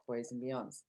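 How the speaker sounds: ordinary talk, nothing marked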